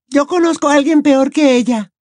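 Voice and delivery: put-on high, fed-up teenage girl; grumbling younger sister; Ginny. Ginny enters annoyed.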